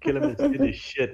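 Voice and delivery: gleeful greedy player. Pretend.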